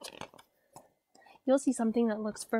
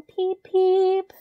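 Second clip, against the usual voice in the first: put-on baby chick peeps; 8 semitones higher, 7 dB louder than usual. Baby duck chirps in poem.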